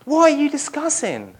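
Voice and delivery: high, innocent what-are-you-discussing; feigned ignorance. Pretend. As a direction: play dumb, eyebrows up. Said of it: "Like he doesn't know".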